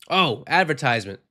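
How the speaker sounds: normal talking voice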